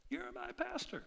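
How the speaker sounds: high, small objecting voice; mock church member. Church member protesting to the pastor, high mock voice.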